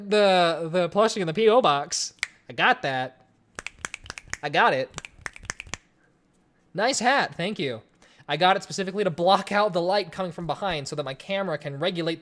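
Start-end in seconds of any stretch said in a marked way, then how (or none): none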